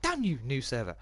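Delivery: sudden high curse at the game; real angry outburst. Real, not acted. Gamer cursing the new server, sudden high outburst. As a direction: snap at the server, pitch jumping way up. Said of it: "Sorry about that, guys".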